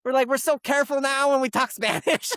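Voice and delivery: high, animated, amused gushing; acted gleeful retelling. Haircutter telling customer it's their favorite story, high amused gushing. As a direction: gush it high, on the edge of laughter. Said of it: "she's dying laughing".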